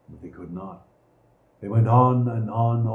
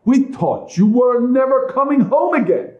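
high, loud scolding wife; scolding stepmother; 10 semitones higher, 5 dB louder than usual. A narrator's reading. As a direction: scold them, shrill with reproach. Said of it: "she said".

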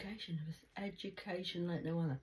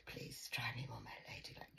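whisper; 7 semitones lower, 12 dB quieter than usual. Retold or imitated. Pleading aside.

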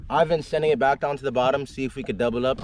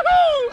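high happy laugh; gleeful laugh; 5 dB louder than usual. Real, not acted. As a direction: laugh with glee. Fish hooked.

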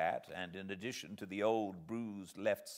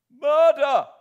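shouting; 19 dB louder than usual. Acted. Voice shouting murder in the marsh.

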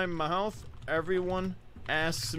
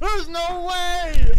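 disbelief; 14 semitones higher, 15 dB louder, much slower than usual. Real, not acted. Reacting, laughing in disbelief.

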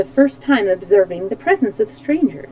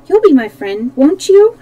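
eager plea, louder and leaping high at the end; pleading; 6 semitones higher, 7 dB louder than usual. A narrator's reading. Polychrome asks Dorothy to be her friend, pleading. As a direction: plead for a yes, pitch climbing. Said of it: "she asked pleadingly".

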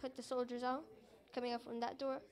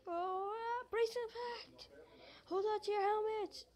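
high, loud brace-for-impact call; urgent crash warning; 7 semitones higher, 3 dB louder than usual. Pretend. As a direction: shout it before the crash.

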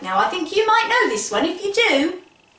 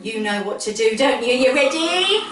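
put-on bright, lively host voice calling kids; kids show host; 4 semitones higher than usual. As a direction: cheer the little ones on. Host asks children to help.

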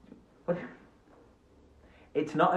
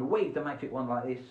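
normal talking voice

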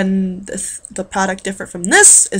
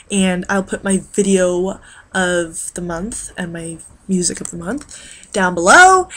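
normal talking voice

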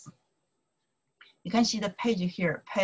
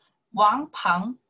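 model line for repeating; 4 dB louder than usual. Shown as a demonstration. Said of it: "Follow me to pronounce here".

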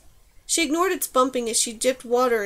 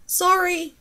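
higher, mildly raised yell; a contained shout back to a companion; 7 semitones higher, 4 dB louder than usual. A narrator's reading. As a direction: call back with a lifted pitch. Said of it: "she yelled".

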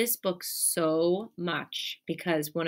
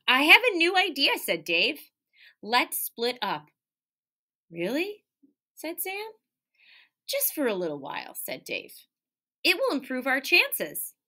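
lively, expressive read-aloud; animated picture-book reading; reading to children; 7 semitones higher, 3 dB louder than usual. Acted. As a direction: read it like storytime for little kids. Teacher reads picture book aloud.

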